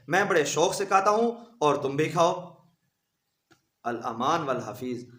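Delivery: ordinary talk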